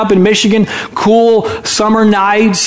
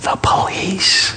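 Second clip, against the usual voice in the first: put-on slow, hushed child's whisper; small whisper; 7 dB quieter, much slower than usual. Child telling telemarketer who's there, whispering. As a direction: whisper it slowly, like a little kid. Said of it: "Still whispering, the voice said".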